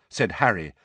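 ordinary delivery

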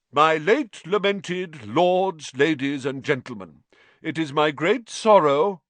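formal speech. A narrator's reading. Nick addressing the ghosts from the podium, oratorical. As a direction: proclaim it grandly to a crowd.

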